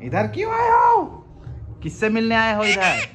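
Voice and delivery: high, called-out questions to a pet; mock-interrogating a bird, pitched high. Real, not acted. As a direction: raise your voice and pitch, demanding an answer. Scolding pet bird that flew over.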